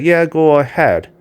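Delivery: ordinary talk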